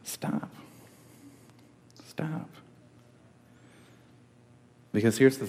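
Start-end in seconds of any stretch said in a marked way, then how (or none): 0.0-0.5 breathy whispered stop, pleading; Jesus gently warning in a whisper; whispered plea. Acted. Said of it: "he says". 2.1-2.5 soft high plea. Acted. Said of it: "he says".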